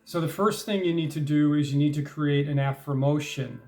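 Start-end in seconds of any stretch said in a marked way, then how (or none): 2.5-3.6 "affirmation" said as affir-MOTION; coined word pronunciation. Shown as a demonstration. Introducing his technique to listeners, "affirmation" pronounced affir-MOTION. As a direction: say "affirmation" with "emotion" as its ending. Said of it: "not an affirmation, an affirmation".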